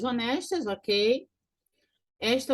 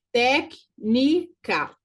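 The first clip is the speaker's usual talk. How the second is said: "Técnica" very slowly split, loud first syllable; 5 dB louder, much slower than usual. Shown as a demonstration. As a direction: punch TÉC in "Técnica", then pause before each syllable. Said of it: "proparoxítona". ("Técnica" pausado, com força na primeira sílaba)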